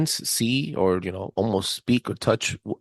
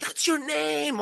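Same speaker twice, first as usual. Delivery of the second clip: high-pitched retold cry of discovery; imitating an excited friend; excited realization; 4 dB quieter, slower than usual. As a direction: exclaim it way up high, thrilled.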